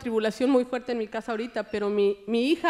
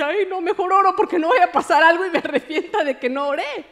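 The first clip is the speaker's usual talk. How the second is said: mock alarm; 6 semitones higher, 5 dB louder than usual. Acting her hurried change of mind.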